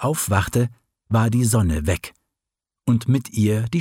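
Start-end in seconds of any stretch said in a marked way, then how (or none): none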